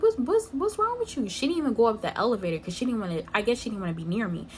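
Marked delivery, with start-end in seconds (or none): 0.0-1.3 yelling. Retold or imitated. Yelling at friend who ignores her.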